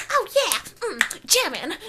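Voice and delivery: hammed-up, high and slow groove; put-on high, loud enthusiasm; mock upbeat gamer. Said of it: "instead of Gamer Pixie being like".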